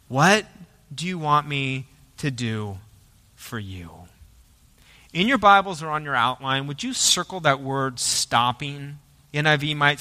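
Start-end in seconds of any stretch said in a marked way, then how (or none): 0.1-3.9 slow, soft, low question with pauses; tender, unhurried offer of help; gentle asking. Acted. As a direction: let it fall away to a hush, phrase by phrase. Jesus stops and answers the blind men.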